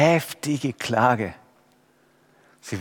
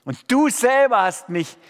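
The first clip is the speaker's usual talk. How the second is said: high, loud accusing cry to God; building to a shouted plea, where are you; loud lament; 9 semitones higher, 3 dB louder than usual. Acted. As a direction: rage at God like a psalm of complaint. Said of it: "Lobpreis im Sinne vom Alten Testament geht so".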